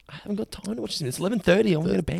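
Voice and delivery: mock a couple begging off for the night; weary excuse. Acting out the couple declining to watch.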